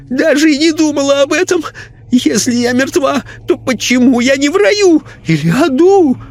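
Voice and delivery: put-on shrieking, crying old lady; piercing cry of denial. A narrator's reading. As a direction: refuse to be dead, terrified. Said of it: "раздался пронзительный крик".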